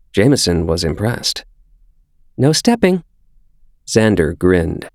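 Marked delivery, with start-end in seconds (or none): 2.4-3.0 put-on high small child voice; a five-year-old reciting the rules; little boy. A narrator's reading. Xander explains proudly.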